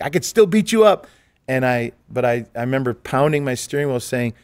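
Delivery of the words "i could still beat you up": high, loud macho boast; a tough guy's sudden loud challenge; tough-guy threat. Retold or imitated. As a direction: puff up and threaten him. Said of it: "I was a tough guy".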